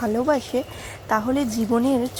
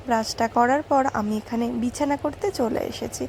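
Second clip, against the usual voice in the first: ordinary delivery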